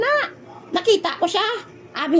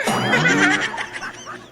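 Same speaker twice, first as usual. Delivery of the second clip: laughing. Acted. Laughs at money story.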